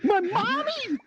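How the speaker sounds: put-on very high, childlike squeal of disbelief; mock horrified shock. Son learning mother was at the party, mock-horrified squeal. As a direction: shoot the pitch up in hammed-up dismay.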